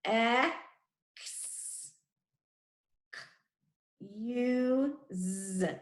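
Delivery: "X, K, U, Z" as separate phonics sounds: eh-ks, k, yoo, zzz; segmented "X, K, U, Z" with a buzzed Z; sounded out. Shown as a demonstration. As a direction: tap out "X, K, U, Z" with long gaps. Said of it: "The Z is tricky".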